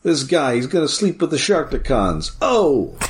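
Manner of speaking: a hammed-up tough-guy comedian bit; Andrew Dice Clay. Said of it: "doing, like, an Andrew Dice Clay thing".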